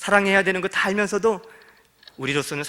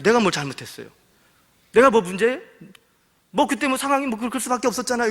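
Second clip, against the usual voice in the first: raised, louder and higher, self-justifying; indignant retort; 6 semitones higher, 4 dB louder than usual. Retold or imitated. The swindler snaps back angrily at the elder. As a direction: argue back heatedly, refusing the blame. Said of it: "오히려 막 화를 내면서".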